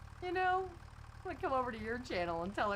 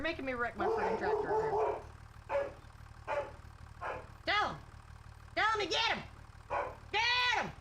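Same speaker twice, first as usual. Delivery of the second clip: yelling at the dogs; 11 semitones higher than usual. Real, not acted. Farmer shouting at her dogs to chase him. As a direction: yell to sic the dogs on him. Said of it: "The guy hears me yelling".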